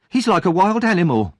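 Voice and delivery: high, loud indignant woman; outraged and rattled after the fight; shaken shopkeeper's wife. Acted. Missus Sowerby, breathless and outraged about Oliver, high and loud.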